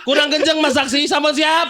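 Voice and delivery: loud, high call to the crowd; hype shout. Real, not acted. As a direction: pump up the crowd. Hyping up the audience.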